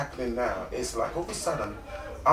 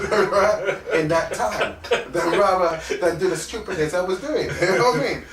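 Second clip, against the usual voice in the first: amused, louder and higher with laughter; laughing; 6 semitones higher, 7 dB louder than usual. Real, not acted. Man mocks his past crime's poor pay, laughing. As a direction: laugh it off, voice raised.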